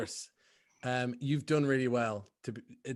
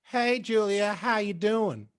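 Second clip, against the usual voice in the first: high, light Southern drawl; Southern-accented creepy kid voice; 7 semitones higher, 3 dB louder, slower than usual. Acted. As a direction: put on a sweet Southern accent. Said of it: "You sound kind of, like, Southern".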